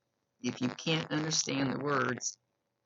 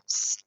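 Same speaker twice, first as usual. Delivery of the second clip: sharp whistly "psst"; short hissed attention sound; imitated lisping psst; 6 semitones lower, 5 dB louder than usual. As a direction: let a sibilant psst slip through the teeth. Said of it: "a little bit of a lisp".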